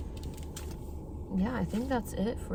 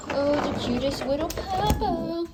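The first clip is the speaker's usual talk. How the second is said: talking to a dog; 10 semitones higher than usual. Real, not acted. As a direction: coo at the puppy. Cooing at the puppy.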